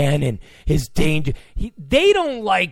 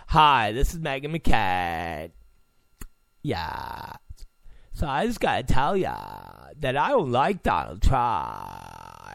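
mocking valley girl voice, lower and softer; lightly voiced valley girl, mocking Meghan McCain; valley girl impression; 8 semitones lower, 4 dB quieter than usual. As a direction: sneer it like a bored valley girl. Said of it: "like listening to some valley girl talk like that".

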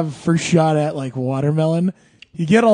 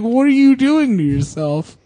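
high, swooping incredulous question; mock dismay, pitch climbing through the question; appalled protest; 7 semitones higher than usual. His body scolding him after a soda binge, appalled protest.

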